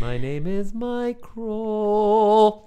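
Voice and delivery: high, drawn-out goofy voice; Steve Brule voice. Retold or imitated. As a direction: stretch it out, high and silly. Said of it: "Like how Steve Brule would say it".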